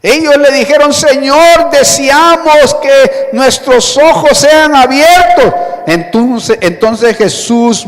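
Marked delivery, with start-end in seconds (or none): 0.9-5.5 slow, high, raised plea; acted shouted request. Blind men tell the Lord what they want, crying out. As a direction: call it out as the blind men, stretched out. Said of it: "A gritos, si es posible".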